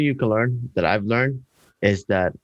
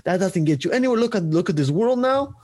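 scolding coworkers; 8 semitones higher than usual. Retold or imitated.

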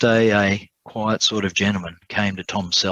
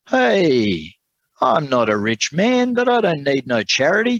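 stiff, proud refusal, pitched higher; stiffly; 6 semitones higher, 5 dB louder than usual. Acted. Tom refusing the lawyer's free help, stiff and higher. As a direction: bristle at the offer of charity. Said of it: "Tom said stiffly".

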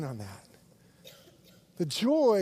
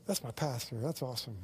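approving aside; 5 semitones lower, 8 dB quieter than usual. Retold or imitated. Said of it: "you'd go".

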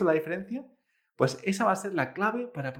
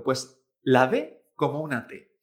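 ordinary delivery

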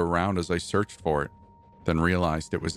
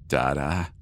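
mild gurgle; 4 semitones lower than usual. Acted. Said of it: "it gurgled".

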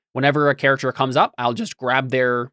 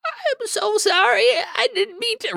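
very high, slow, pitiful squeak; pitiful attacked character. Acted. Attacked character guilt-tripping the players, pitiful. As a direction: squeal a hammed-up, piteous apology. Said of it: "you immediately have them sound".

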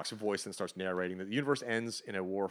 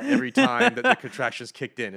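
emphatic; 12 dB louder than usual. Real, not acted. Loud retelling of wife's grip.

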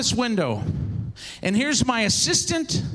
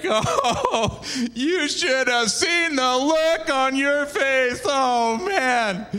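slow, strained mock-laughing voice; drawn-out teasing delight; gloating amusement; much slower than usual. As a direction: drag it out as if cracking up. Pranksters gloating over the reaction.

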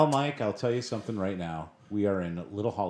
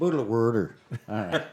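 mimicked Philadelphia accent. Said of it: "apparently, in Philadelphia".

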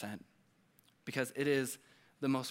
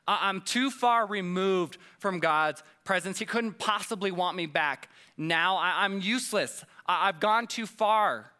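raised, pleading despair, acted out; higher, louder self-condemning outburst; 5 semitones higher, 5 dB louder than usual. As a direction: play Jonah convinced he is beyond saving. Acting out Jonah's despairing thought.

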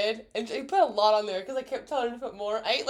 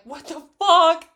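a louder, stunned retold outburst; shocked swear; 7 semitones higher, 12 dB louder than usual. Friend retells hearing grandfather died, loud shocked. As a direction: blurt it out in disbelief. Shocked reaction to death call.

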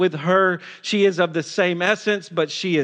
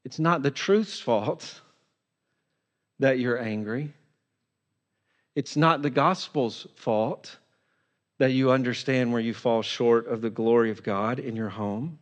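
soft earnest; 7 semitones lower, 6 dB quieter than usual. Real, not acted. Pastor softens for hard truth.

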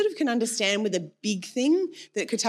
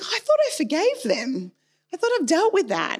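exasperated disbelief; 8 semitones higher, 4 dB louder than usual. Retold or imitated. Frustrated when anger returns.

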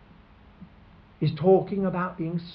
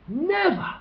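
much higher, ringing never; outraged never; 12 semitones higher than usual. Retold or imitated. Listeners rejecting the execution, high and outraged. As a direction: cry it out in protest. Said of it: "We would immediately think".

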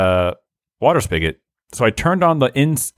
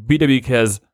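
normal talking voice

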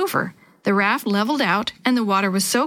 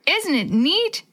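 calling out; 8 semitones higher, slower than usual. A narrator's reading. Girl calls to a friend on the raft, mild high call. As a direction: call brightly across to a friend, contained. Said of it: "Dee Dee called to Willie".

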